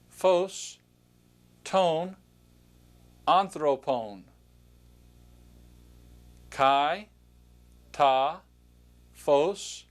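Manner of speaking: slow word-by-word Greek pronunciation, each word set apart; reading Greek aloud. Shown as a demonstration. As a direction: pronounce each Greek word clearly for learners to repeat. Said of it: "point to the word and just pronounce it".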